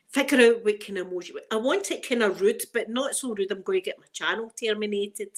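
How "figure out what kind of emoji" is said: normal talking voice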